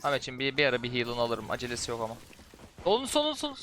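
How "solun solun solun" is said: sharp high warning, repeated; urgent callout. Real, not acted. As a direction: call it out fast to warn.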